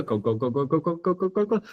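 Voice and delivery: soft glugs climbing high in pitch; imitated pouring water. Shown as a demonstration. Showing water pouring towards completion, soft rising glug sounds. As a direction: glug softly upward like a vessel filling. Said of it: "Pouring water".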